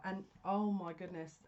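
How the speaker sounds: ordinary talk, nothing marked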